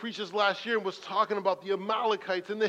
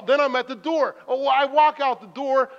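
ordinary delivery

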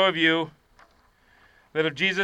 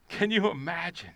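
normal talking voice

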